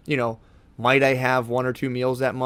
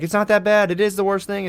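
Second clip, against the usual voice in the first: higher, louder, mocking tone; mocking dismissive quote; 8 semitones higher, 3 dB louder than usual. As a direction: parody the people brushing it off. Mocking people who dismiss it.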